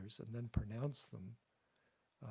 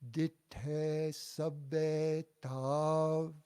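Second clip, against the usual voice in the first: "Ditte sabbe tav" very slowly and loudly, syllable by syllable, higher; slow model line; 4 semitones higher, 8 dB louder, much slower than usual. Shown as a demonstration. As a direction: draw out "Ditte sabbe tav" for the class to echo. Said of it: "you repeat it after me".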